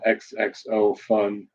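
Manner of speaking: each word drawn out slowly; slow reading. Retold or imitated. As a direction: read it slowly, savouring the note. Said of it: "The brochure said".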